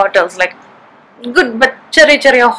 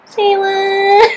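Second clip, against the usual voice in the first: surprised; 8 semitones higher, 3 dB quieter than usual. Real, not acted. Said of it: "her reaction".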